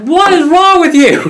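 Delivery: high loud mock exasperated shriek; wife cries out in disbelief, high and loud; incredulous outburst. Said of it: "She's like".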